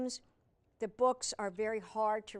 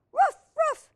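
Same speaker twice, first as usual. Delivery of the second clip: two very high, loud puppy barks; 14 dB louder than usual. Acted. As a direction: yap twice like an excited puppy.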